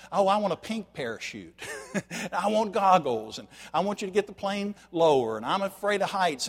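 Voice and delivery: petulant complaining passenger voice; whiny passenger. Retold or imitated. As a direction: whine like a fussy passenger refusing to jump. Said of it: "they begin to whine".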